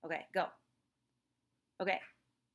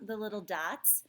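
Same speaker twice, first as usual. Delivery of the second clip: ordinary talk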